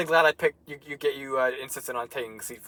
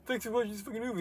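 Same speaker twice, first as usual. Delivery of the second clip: mock thank-you voice; 9 semitones higher, 7 dB quieter, faster than usual. Mocking the thank-you message.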